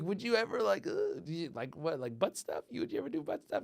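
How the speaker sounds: high, nervous mock squeak; hammed-up timid question; squeaky awkward ask. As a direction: ask it like a shy teenager.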